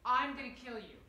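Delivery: loud furious threat; angry shout. Shown as a demonstration. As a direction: hurl it at them. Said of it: "It's all from".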